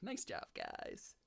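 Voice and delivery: low, slow, soft voice; imitating sister. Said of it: "she's like".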